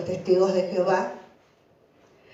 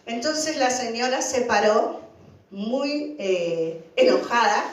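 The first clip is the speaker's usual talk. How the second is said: ordinary talk, nothing marked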